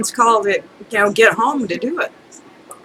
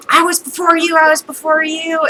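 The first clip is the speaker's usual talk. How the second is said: higher-pitched mock whiny protest; put-on indignant customer; imitated demanding complaint; 6 semitones higher than usual. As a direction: mimic a pushy customer insisting. Acted complaint of a pushy customer.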